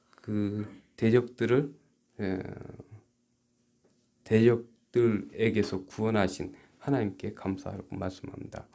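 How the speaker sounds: usual speaking voice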